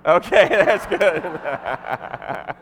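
loud, drawn-out laugh through the words. Real, not acted. Storyteller asking Rich if he remembers that, laughing. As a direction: break up laughing as you speak.

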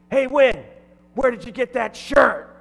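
high, loud heckling yell; gruff shout. Retold or imitated. Gruff man heckling the MC about his shirt, gruff shout. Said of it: "he was kinda gruff".

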